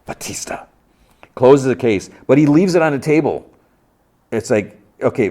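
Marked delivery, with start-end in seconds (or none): 0.1-0.7 whispered name. Retold or imitated. Dexter hears his old colleague singing.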